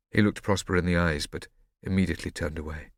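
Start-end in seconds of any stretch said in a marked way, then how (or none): none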